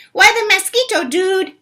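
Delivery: high, loud little dog voice; high squeaky small dog; tiny dog voice. Acted. Smallest Chihuahua asks.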